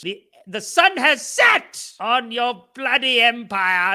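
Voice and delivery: the sun has set, gloating slowly; mock triumphant proclamation. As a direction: proclaim the end of an empire, hammed-up. Gloating over the fallen empire.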